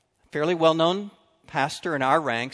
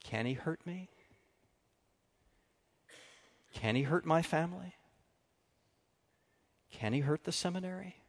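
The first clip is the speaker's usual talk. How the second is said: soft, anxious questions, much quieter; mild worried murmur to himself; fearful inner questions; 9 dB quieter than usual. Retold or imitated. Retold fearful thoughts.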